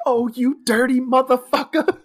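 high, put-on seductive purr.

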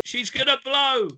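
exclaimed, high-pitched quote; mock warning cry. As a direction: call it out like a warning. Acting out a quiz quote.

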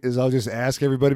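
ordinary delivery

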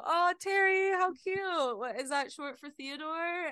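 high, cooing delight; gushing high voice. Retold or imitated. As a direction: gush over a cute baby name. Stranger gushing over baby's name.

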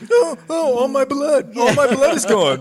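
high, loud comic wailing; hammed-up dying victim, half laughing; mock agony. Acts out gator victim bleeding out.

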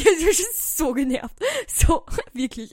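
loud, laughing exasperation; "genervt" said loud with a laugh; exasperated outburst. Real, not acted. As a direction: blurt out how fed up you were, laughing.

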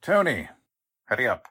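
calling out. Acted. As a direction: summon Tony from upstairs. Boss calling his henchman.

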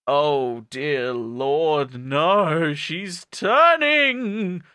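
slow melodramatic build to a high cry; hammed-up dread, rising and stretching; mock horror. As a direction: start measured, climb into drawn-out alarm. Melodrama as Purple turns.

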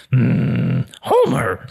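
raspy cartoon mom murmuring; Marge Simpson. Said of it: "the only voice that I can do is Marge Simpson".

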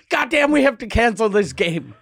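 loud, high complaint from annoyed fans; mock outrage. Voicing tennis people when rain comes, mock outrage. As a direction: exclaim in exaggerated frustration.

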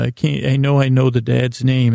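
ordinary talk

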